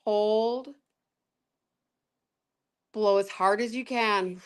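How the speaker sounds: calm breathing coach voice; guided breathing. Acted. Leads breathing exercise.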